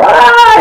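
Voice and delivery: high-pitched mock scream; imitated scream. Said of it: "He's just screaming".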